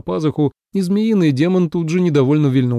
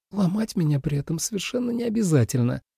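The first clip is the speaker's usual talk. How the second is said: softer, higher annoyed complaint; displeased; 5 semitones higher, 5 dB quieter than usual. A narrator's reading. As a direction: grumble it, put out and squeezed. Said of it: "недовольно заметила Су Илань".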